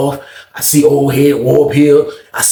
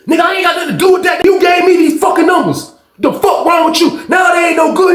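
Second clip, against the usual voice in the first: angry yelling; 14 semitones higher than usual. Retold or imitated. Narrator yelling back.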